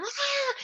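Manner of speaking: high, drawn-out mock cry, held back; imitated scream. Woman moving through contractions, high mock scream.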